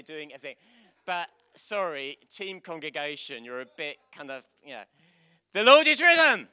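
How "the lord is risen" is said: high, loud Easter shout; loud proclamation. Acted. Leader prompting the congregation's response, shouted. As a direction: call it out to the whole church. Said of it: "That's more like it".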